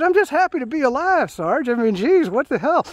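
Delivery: high, loud giddy reply; answering the angry sergeant through laughter; laughing protest. Retold or imitated. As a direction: laugh it out, thrilled to be alive. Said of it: "I said".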